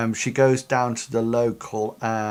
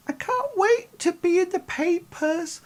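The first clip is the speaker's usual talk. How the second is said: Betty's voice, pitched way up, dreamy and eager; high character voice. Acted. Game master voicing Betty, eager for fame, in a high voice. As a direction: play her high and breathless with hope.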